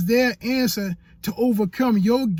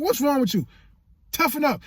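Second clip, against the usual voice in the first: a lightly voiced wife telling him off, a bit higher; wife's scolding; 7 semitones higher than usual. Retelling his wife scolding him over his hand, lightly voiced scold. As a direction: mimic the wife scolding, only a touch put on. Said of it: "my wife looking at me".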